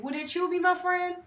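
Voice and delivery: ordinary talk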